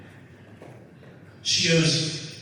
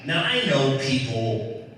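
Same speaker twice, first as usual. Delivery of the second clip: normal talking voice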